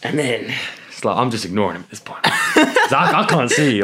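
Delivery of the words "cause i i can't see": high, loud words through laughter; laughing. Real, not acted. Storyteller cracking up over the driver beside him, laughing. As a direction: crack up while explaining.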